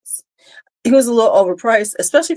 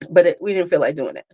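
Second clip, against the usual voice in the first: usual speaking voice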